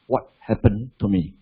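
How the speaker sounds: ordinary delivery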